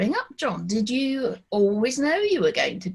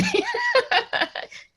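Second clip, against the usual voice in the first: laugh. Real, not acted. Laughs at own remark.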